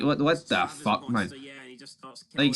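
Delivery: normal talking voice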